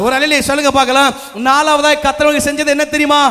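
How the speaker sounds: ordinary delivery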